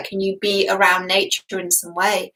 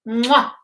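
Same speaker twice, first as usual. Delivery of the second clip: air kiss; 3 semitones higher than usual. Real, not acted. Blowing a kiss to viewers.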